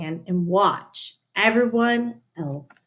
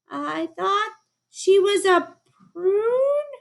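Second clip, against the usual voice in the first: higher, slow feeble excuse; sheepish excuse; 13 semitones higher, slower than usual. A narrator's reading. Teacher excusing herself to the principal, higher and slow. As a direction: caught out, make a lame excuse. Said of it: "said Missus Ferdman".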